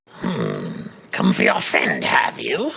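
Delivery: low, rasping goblin boss taunt. Acted. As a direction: sneer it like a goblin chief.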